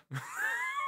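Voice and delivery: very high, soft squeal of laughter; shocked laugh. Real, not acted. Streamer reacting to an absurd video, shocked laugh. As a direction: burst into a squeaky, helpless laugh.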